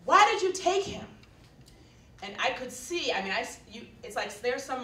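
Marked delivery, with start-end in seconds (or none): 0.0-1.1 loud, high shout at the officer; retold yell. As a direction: yell it out in protest. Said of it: "somebody yells".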